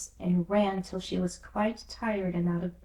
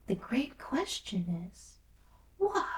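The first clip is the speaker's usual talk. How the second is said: slow pondering; slower than usual. Acted. Alice ponders slowly.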